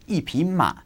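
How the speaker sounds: yi in yi bei cha falling sharply, fourth tone. Shown as a demonstration. Teacher demonstrating to learners, yi falling. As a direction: drop yi hard in yi bei cha. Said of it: "fourth tone".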